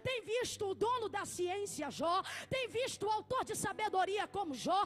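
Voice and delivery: loud, driving sermon delivery; full-force preaching. Acted. Preacher exalting Job before the church, full-force preaching.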